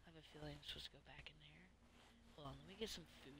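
quick unvoiced whisper to self; fast whisper. Real, not acted. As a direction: rush it under your breath. Muttering to self in game.

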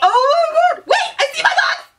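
high, loud laughing squeal; excited shriek. Real, not acted. Fan spotting her box in the video, high laughing squeal. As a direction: squeal with joy as you spot it.

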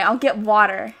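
ordinary delivery

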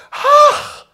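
put-on very high, loud little gasp of an old grandmother; a startled old lady's ach; overwhelmed grandmother gasping; grandmother's gasp. A narrator's reading. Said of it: "machte die Großmutter".

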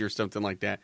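usual speaking voice

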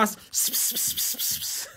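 breathy hissing s- stutter, no voice; lisped s sounds. Shown as a demonstration. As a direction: spit out s- s- s- as pure hiss. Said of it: "all you hear through all of my".

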